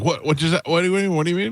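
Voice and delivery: high, rising, flustered questions; mock bewilderment. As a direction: act out a baffled prank victim. Acting out a confused prank victim.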